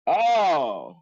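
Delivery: loud, high "oh" that swoops down; sudden realisation. Real, not acted. Finally getting what the slang means, loud realisation. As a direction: burst out as it finally clicks.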